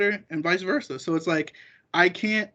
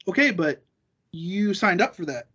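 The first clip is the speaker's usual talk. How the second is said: ordinary delivery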